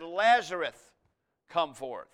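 loud call on the name, then easing off; loud cry. Retold or imitated. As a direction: imitate a commanding summons in moderation. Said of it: "he cried loudly".

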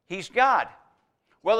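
ordinary delivery

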